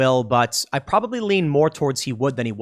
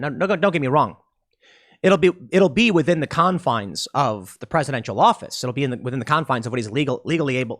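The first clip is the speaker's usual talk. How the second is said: ordinary talk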